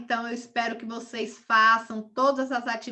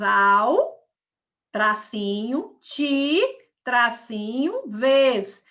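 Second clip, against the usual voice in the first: "Al", "ti", "vez" each loud and separate, slower "tracinho" between; syllable by syllable; 6 dB louder, much slower than usual. Shown as a demonstration. Teacher showing pupils where hyphens go, "Al", "ti", "vez" punched separately. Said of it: "altivez tem três sílabas".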